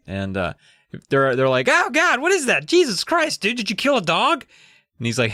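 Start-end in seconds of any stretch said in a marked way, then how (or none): 1.7-4.5 put-on much higher, freaked-out kid voice; shocked kids. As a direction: squeal it like a spooked kid.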